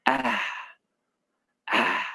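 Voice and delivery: आ ending in a soft h breath; vowel demo with breathy end. Shown as a demonstration. Teacher showing the h at the end of आ, soft trailing आ. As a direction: let आ trail off into an h. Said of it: "अंत में ह ऐसा उच्चारण होता है".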